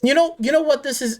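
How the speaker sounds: ordinary talk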